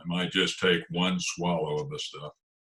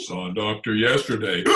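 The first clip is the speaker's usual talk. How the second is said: ordinary talk, nothing marked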